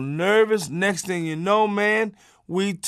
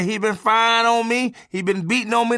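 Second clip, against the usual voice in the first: wife playing the victim; 7 semitones higher than usual. Wife plays victim to police.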